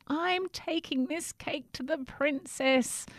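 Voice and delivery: high, slow pleading voice; pleading cry. Acted. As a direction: beg to be let through. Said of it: "cried Jack".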